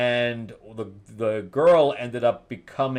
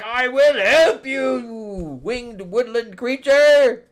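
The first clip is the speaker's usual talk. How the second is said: higher, louder, slow solemn giant; gentle giant; 13 semitones higher, 7 dB louder, slower than usual. Retold or imitated. Giant Ymir agreeing to help Grace, slow and high. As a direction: grant it slowly like a kind giant. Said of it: "And he's like".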